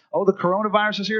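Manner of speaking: normal talking voice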